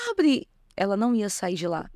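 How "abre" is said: put-on higher, louder insistent child's demand; the stubborn niece won't give up; child shouting. Niece at the door insisting her aunt open up, higher mock shout.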